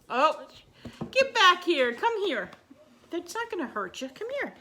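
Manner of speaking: high, coaxing call to a pet. Real, not acted. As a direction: lure the dog back. Coaxing nervous dog.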